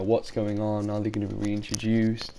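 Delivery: ordinary talk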